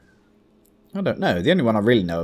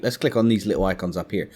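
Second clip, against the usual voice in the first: ordinary talk, nothing marked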